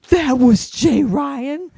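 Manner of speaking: high, drawn-out, rising disbelief; astonished realization. Retold or imitated. Kid learning whom they just chatted with, drawn-out astonishment. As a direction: imitate a stunned kid, stretched right out.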